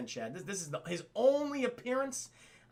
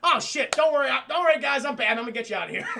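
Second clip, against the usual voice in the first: high, loud urgent hero; urgent reassurance; 5 semitones higher, 8 dB louder, faster than usual. Retold or imitated. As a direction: blurt it out to rally the others. Acting the hero's urgent reassurance.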